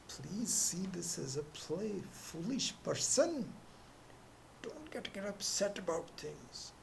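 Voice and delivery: high, hushed chiding voice; half-whispered scolding of a foolish person; scripture's admonition. Retold or imitated. The Shruti gently chides a foolish person, hushed and high.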